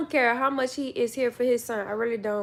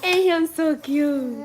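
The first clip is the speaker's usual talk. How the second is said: put-on slow, higher cooing baby voice; cooing baby talk; 5 semitones higher, 6 dB louder, slower than usual. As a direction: gush over the baby.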